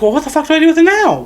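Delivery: high, fast mock exasperation; exasperated retelling. Retold blacksmith stuck with a body.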